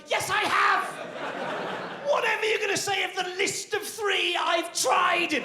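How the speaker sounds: high, shouting exasperation; exasperated retort. Retold or imitated. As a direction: snap back, fed up, pitched high. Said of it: "exasperated insomniac retort".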